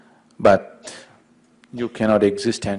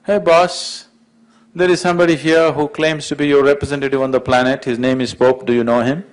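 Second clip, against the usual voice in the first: calling out loudly toward the gate; a loud call across heaven's gate; raised voice; 5 semitones higher, 4 dB louder than usual. Acted. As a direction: shout it up to the boss inside. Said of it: "He raised his voice and said".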